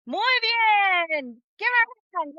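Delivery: high, drawn-out cheer for a student; whooping teacher's applause call; excited praise. Real, not acted.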